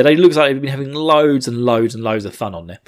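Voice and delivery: ordinary talk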